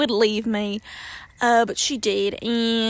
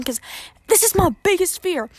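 ordinary talk

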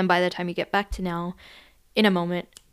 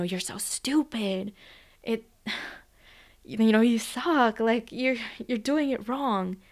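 imitating a harsh father in a whisper, then higher; mild mock of a scolding dad, breathy then raised; father's harsh voice; 3 semitones higher, slower than usual. Coach retelling a client's memory, a hint of a berating father. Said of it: "I heard my father's voice, um, say".

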